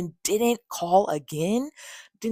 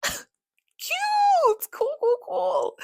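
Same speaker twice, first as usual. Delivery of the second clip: squeaky high, laughing, slow sarcastic cool; bitter laugh through a shrill high voice; 3 dB louder, much slower than usual. Real, not acted. Sarcastic reaction to refusal.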